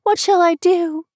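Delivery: put-on high-pitched, loud girlish lament; distressed lament. Girl lamenting the broken vase, distressed.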